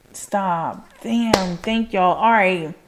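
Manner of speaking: ordinary talk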